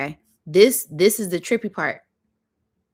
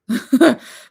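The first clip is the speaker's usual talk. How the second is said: loud high burst of laughter; 6 semitones higher, 9 dB louder than usual. Real, not acted. Telling the dream to viewers, a loud laugh bursts out.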